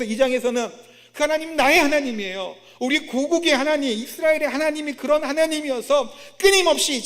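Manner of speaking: loud, high indignant harangue; acted outraged complaint; resentful protest. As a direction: argue Jonah's grievance at full volume, stretching the end. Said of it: "그게 싫은 거예요".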